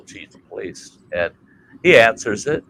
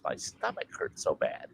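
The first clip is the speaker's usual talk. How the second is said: whispered complaint; 5 semitones lower, 15 dB quieter than usual. Retold or imitated. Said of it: "He says".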